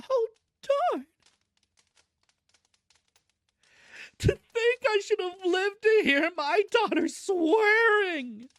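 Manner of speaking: high, loud melodramatic wail; sobbing. A narrator's reading. Mother aghast at daughter swearing, hammed-up sobbing. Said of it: "sobbed Missus Frederick".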